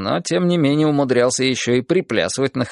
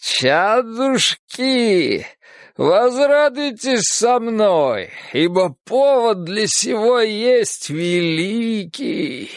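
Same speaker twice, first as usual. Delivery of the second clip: slow, higher drunken mock-preaching; slurred drunk speech; 8 semitones higher, much slower than usual. A narrator's reading. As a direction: slur like a blissful drunk prophet. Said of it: "заплетающимся языком заговорил он". (пьяная заплетающаяся речь)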